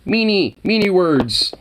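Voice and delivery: high, childish mocking voice; mocking prude. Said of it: "It's like".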